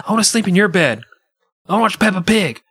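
put-on whiny child voice. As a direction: beg like a small child at night. Voicing the child.

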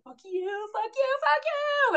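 rising, high, shaking fuck yous; shaking with rage at the spirit guide; shaking angry. Retold or imitated. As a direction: spit it out over and over, shaking.

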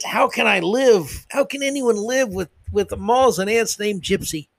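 high-pitched mock despair; mock anguish. Host feigning torment over two insect names, mock anguish. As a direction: plead in exaggerated, sarcastic distress.

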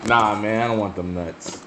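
usual speaking voice

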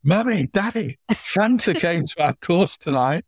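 put-on high, breathless little kid's news; a small child bursting to tell his parents; child telling mum and dad, high and excited. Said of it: "that child would have gone home".

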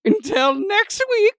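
high, slow cackling witch; old witch voice. Acted. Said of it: "your line as the old witch".

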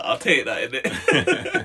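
laughing, voice jumping high on innit. Real, not acted. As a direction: chuckle it out, pleased. Laughs at his nickname.

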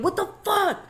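high-pitched mock outburst; cousin cursing. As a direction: imitate a cousin swearing mid-game. Cousin swearing during a game, acted.

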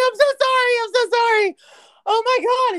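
high, loud, wailed apology; retold mortified wailing; frantic apology. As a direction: beg forgiveness in mock panic.

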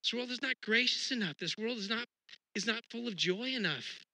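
high, pointed dismissal; dissatisfied. Retold or imitated. Looking at the world, scoffing not good enough. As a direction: scoff at how things are.

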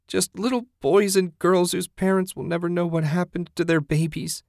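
high, distressed, plaintive voice; whined. A narrator's reading. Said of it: "she whined".

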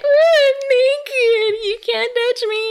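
high, drawn-out teasing sing-song; exaggerated coy taunting; mock teasing taunt. Teasing heroine acted in retelling.

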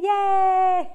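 high, loud yay; excited cheer. Real, not acted. Host cheers that she gets to go swimming today.

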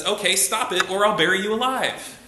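hammed-up fed-up psychologist yelling stop it; Bob Newhart. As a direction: bark it like an impatient shrink. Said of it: "He says".

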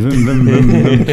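vroom like an idling truck; punchy mock engine noise; imitated engine revs. Joker imagines idling his huge truck for fun, imitated engine revs.

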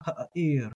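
ha'ir with a little throaty uh sound; guttural ayin. Shown as a demonstration. Teacher stresses the ayin in ha'ir, throaty catch. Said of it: "You're gonna hear that little uh".

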